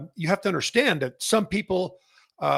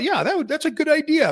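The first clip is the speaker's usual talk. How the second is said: "Yeah, that would, that's a good idea" jumps way higher, with eager enthusiasm; cheerfully agree, pitched up high; high, eager thought; 10 semitones higher, 3 dB louder than usual. Retold or imitated. Host recalling his first reaction to a viewer's suggestion, bright and high. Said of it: "And I thought".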